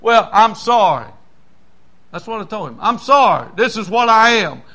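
loud firm preaching shout; defiant declaration. Retold or imitated. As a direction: stand your ground proudly. Defiant reply to boss.

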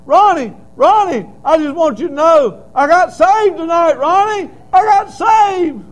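high, loud, tearful cry of news; crying out with joy. Retold or imitated. Brother phoning the preacher at night, tearful loud cry. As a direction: shout it through tears of relief. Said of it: "he called me crying".

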